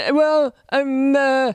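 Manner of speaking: hesitant dim mock-boyfriend voice; dopey boyfriend imitation. Boyfriend asked about breaking up, dopey stammer. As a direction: hem and haw like a clueless boyfriend. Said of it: "this is how my boyfriend sounds to me".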